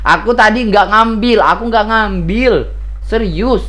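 earnest repeated insistence; insisting. Real, not acted. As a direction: swear you did not take it. Insisting he took nothing.

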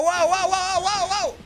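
ordinary delivery

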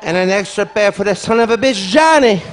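loud, rising mock prayer ending in a yell; hammed-up grandma spits out the name; grandma's curse. Grandmother praying with the rosary.

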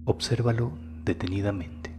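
guided meditation. Acted. Guide leading listeners through sacral chakra breathing, soft guided meditation. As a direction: guide the listener inward, softly and unhurriedly.

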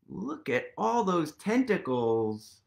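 plain reading voice

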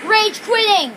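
frustrated shouting. Real, not acted. Rage-quitting player to amused viewers, indignant shouting. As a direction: shout indignantly at people who find it funny.

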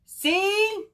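high, loud, stretched "see"; excited little bird calling out; triumphant call. A narrator's reading. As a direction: show off proudly, loud and high. Bird showing everyone he is better.

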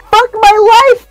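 high, loud despairing cry; narrated shout. A narrator's reading. Brian answering the megalodon, acted shout. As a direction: yell it out as the exasperated character. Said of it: "Brian shouted back".

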